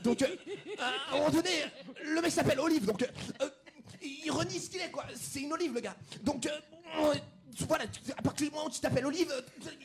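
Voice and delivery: high, quiet woman's voice; Jamila impression. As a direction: pitch it up and keep it soft.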